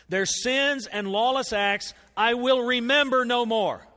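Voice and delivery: slow, loud and weighty, word by word; preacher leaning hard on each word; emphatic slow scripture reading. Acted. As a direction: let every word of the promise land. Pastor reads scripture with emphasis.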